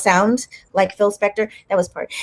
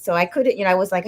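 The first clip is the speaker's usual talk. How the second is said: ordinary talk, nothing marked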